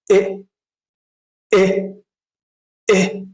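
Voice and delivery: very short "إيه", a quick "eh"; first E sound. Shown as a demonstration. Teacher reviews the first pronunciation, short clean "إيه". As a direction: stop the vowel of "إيه" straight away. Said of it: "النطق الأول كان إيه؟".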